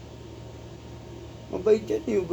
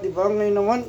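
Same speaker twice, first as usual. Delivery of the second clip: ordinary delivery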